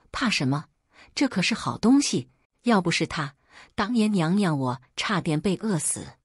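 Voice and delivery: ordinary delivery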